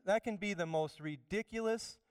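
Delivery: ordinary talk, nothing marked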